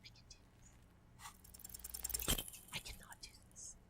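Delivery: barely audible self-talk; nervous whisper. Real, not acted. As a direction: whisper to yourself, losing confidence. Nervous self-talk before the shot.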